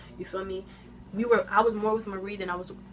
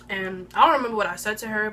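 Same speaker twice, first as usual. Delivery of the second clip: ordinary talk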